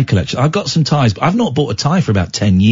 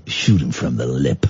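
very low, softer catchphrase voice; mock quote; 5 dB quieter than usual. Host savouring the catchphrase, low mock quote. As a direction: sink even deeper for the quoted line.

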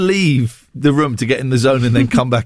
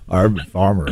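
put-on West Country accent; 8 semitones lower than usual. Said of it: "West Country".

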